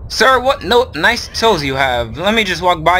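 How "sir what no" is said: usual speaking voice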